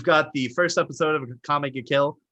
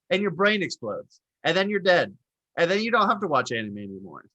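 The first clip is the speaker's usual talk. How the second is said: ordinary talk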